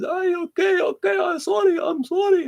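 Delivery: a hammed-up yelp for mercy, high and loud; mock scream. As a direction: screech the surrender like a bully in pain. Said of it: "The guy's screaming in the stairway".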